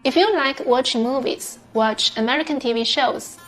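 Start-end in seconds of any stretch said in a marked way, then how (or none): none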